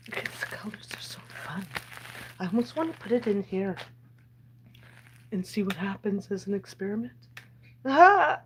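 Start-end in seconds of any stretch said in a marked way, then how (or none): 0.1-1.6 soft unvoiced whisper. Real, not acted. Whispering again while admiring candy. 7.8-8.4 excited. Real, not acted. Sudden excited outburst.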